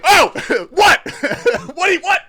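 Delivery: high, loud, laughing shriek of surprise; startled laughing yell. Real, not acted. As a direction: caught off guard, yelp and laugh at once. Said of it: "I don't think I yelled. Maybe I yelled".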